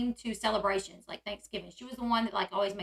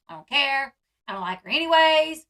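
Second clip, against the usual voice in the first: mock dismissiveness; 2 semitones higher, 7 dB louder than usual. Imitating Cody's usual dismissive complaint.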